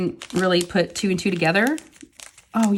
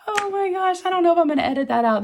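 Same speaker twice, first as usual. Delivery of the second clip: giggly embarrassment; 12 semitones higher than usual. Real, not acted. Laughing after burping on camera.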